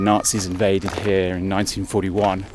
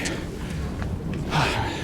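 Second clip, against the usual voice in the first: tired sigh; 2 semitones higher, 8 dB quieter than usual. Real, not acted. Winded on the climb, pausing.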